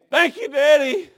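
put-on high, loud little-girl wail; imitated crying child. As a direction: echo the toddler's tearful cry, loudly. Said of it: "she would be crying, and she'd do this".

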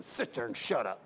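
quietly menacing pastor; low stern command. Retold or imitated. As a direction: pastor warning a child, low and firm. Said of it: "I said".

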